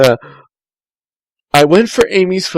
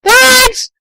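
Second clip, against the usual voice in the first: high, loud thanks called out; yelled thanks; 12 semitones higher, 6 dB louder than usual. Acted. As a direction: shout it back over the shoulder. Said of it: "I yelled".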